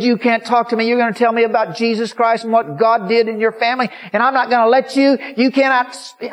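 high, loud retold ranting; angry tirade. Old woman refuses to hear testimony, angry tirade. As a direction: rant over any interruption, high and loud.

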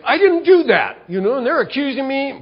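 high, loud outraged denial; falsely accused man protesting, loud and high; indignant protest. Retold or imitated. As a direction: blurt out your innocence.

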